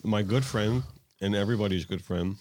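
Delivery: loud, upbeat podcast host welcome; show host intro. Acted. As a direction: open the show like an announcer.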